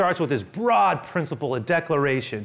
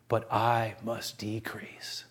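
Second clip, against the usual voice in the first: slow breathy whisper of awe; hushed whisper; 7 semitones lower, 10 dB quieter, much slower than usual. Acted. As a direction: let the words fall to a reverent whisper. Awed, hushed climax of verse.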